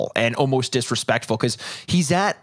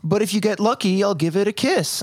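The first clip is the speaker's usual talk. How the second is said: higher, playful imitation of coaxing; mock coaxing; 6 semitones higher than usual. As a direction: lightly tease it like a bargain. Voices friend's coaxing reply.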